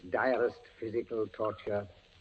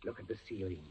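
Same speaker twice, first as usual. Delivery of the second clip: menacing whisper; 8 dB quieter, much faster than usual. Acted. Inquisitor hushes, reveals pendulum.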